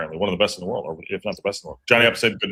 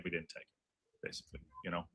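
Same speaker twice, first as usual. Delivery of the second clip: quiet resigned; 4 semitones lower, 19 dB quieter than usual. Real, not acted. Resigned after missed chance.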